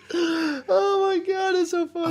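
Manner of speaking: high, squealing laugh-cry; crying with laughter. Real, not acted. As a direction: wheeze through laughter. Listener cracking up.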